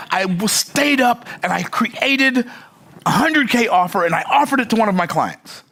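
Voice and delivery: gushing Australian excitement; mock excited Australian voice; excited Australian accent. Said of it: "my Australian accent's not that good".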